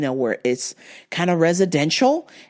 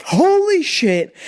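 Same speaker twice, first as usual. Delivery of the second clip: high, loud, slow Holy shit; alarmed exclaim; 9 semitones higher, 4 dB louder, much slower than usual. Retold or imitated. Driver about to rear-end a car, alarmed exclaim. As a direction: gasp it out in alarm. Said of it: "And I'm like".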